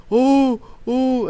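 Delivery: high, loud imitation wail; mock crying. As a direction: imitate a beaten kid wailing for his brother. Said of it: "he was crying for his older brother".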